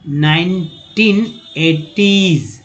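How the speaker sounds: नाइनटीन एटीज spoken very slowly, each part clear; teacher drawing out नाइनटीन एटीज; slow model pronunciation. Shown as a demonstration. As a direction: stretch नाइनटीन एटीज out for the class. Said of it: "आप तो बोलते भी हो नाइनटीन एटीज".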